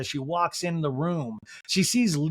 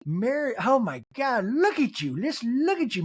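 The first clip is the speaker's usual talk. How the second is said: high, fawning imitation; mock-adoring high coo; gushing admiration; 7 semitones higher than usual. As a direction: gush as if starstruck, pitched up. Meredith fawning over Mary.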